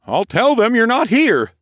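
high, loud agreement; compliant guard. A narrator's reading. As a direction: obedient under a spell.

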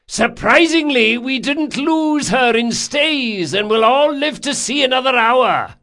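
high, loud, sardonic announcement; shouted report. A narrator's reading. Sailing master to captain, shouted report. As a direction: call out the grudging good news.

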